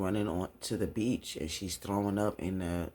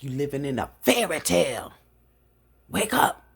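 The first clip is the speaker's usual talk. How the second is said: loud, high outburst, building; exasperated shouting; 5 semitones higher, 8 dB louder than usual. Retold or imitated. Raymond berating his wife for believing her father, shouting. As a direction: shout to jolt someone awake.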